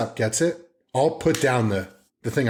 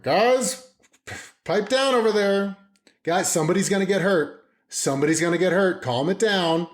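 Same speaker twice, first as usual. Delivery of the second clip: raised-pitch acted parental warning; annoyed dad; 7 semitones higher than usual. Busy dad telling kids to pipe down, annoyed warning. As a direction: call out to noisy kids, exasperated. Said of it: "My kids are being annoying as fuck".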